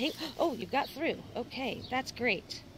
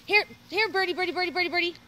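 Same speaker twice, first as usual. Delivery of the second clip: luring a wild bird; 7 semitones higher, 7 dB louder, faster than usual. Real, not acted. Coaxing the bird out.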